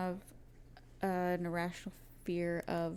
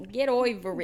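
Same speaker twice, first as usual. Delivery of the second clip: hammed-up New York accent, louder; mock New York accent; 2 semitones higher, 5 dB louder than usual. Shown as a demonstration. Cohost hams the catchphrase in New York accent. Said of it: "with, like, a New York accent".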